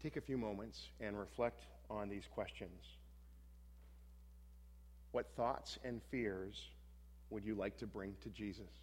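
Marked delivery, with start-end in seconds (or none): 5.1-8.7 slow reflective. Shown as a demonstration. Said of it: "slow reflective prompt".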